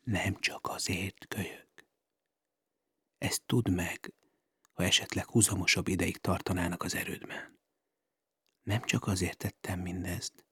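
quiet confession leaning in close; hushed into her ear. Acted. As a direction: murmur it privately into her ear. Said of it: "ezt csukta a fülébe".

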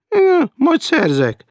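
very high, loud, slow sneer; mocking defiance. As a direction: drawl it out to taunt him. Hecker mocks threat of hunger.

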